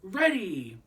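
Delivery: a loud, high call to the crowd; a big starting-line shout; cried out. Acted. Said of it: "and cried".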